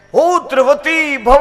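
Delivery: slow, loud and high divine blessing; solemn blessing. Acted. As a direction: bestow the boon slowly, with full force. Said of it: "સૂર્યદેવ કહે". (ધીમા, ઊંચા, જોરદાર અવાજે આશીર્વાદ)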